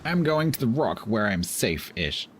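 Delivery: normal talking voice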